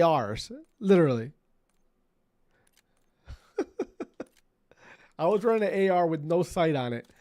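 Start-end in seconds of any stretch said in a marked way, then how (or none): 3.6-4.2 high squeaky laugh. Real, not acted. Laughs after repeating the story.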